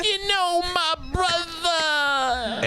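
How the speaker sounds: jive voice. Acted. Jive voice bit on wrestlers.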